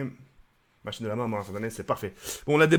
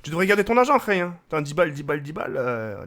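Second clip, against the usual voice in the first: ordinary talk, nothing marked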